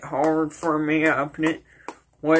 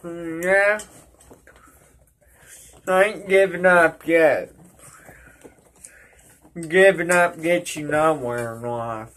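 talking around tobacco lip; slightly muffled wet speech; 3 dB louder, faster than usual. Real, not acted. Seen 4: spits into spittoon before and after; lower lip looks full.

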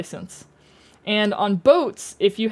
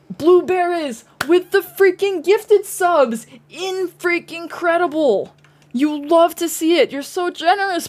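excited shout; 8 semitones higher than usual. Real, not acted. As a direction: gush with thrill. Celebrating gifted subs.

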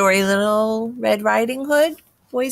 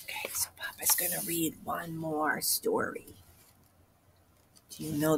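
whispering; 10 semitones lower, 13 dB quieter than usual. Acted. Weary papa sighs, whispered.

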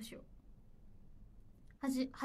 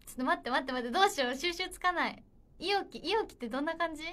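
loud, higher-pitched fluster; real flustered panic; 5 semitones higher, 7 dB louder than usual. Real, not acted. Idol at a loss over a viewer's impression request, loudly flustered. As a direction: blurt it out, losing control of the bit.

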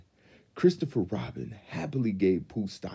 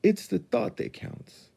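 brave little Piglet. A narrator's reading. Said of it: "trying to smile bravely".

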